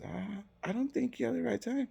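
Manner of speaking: high, soft mimicked secretary; high-voiced, softened doubt; doubtful secretary.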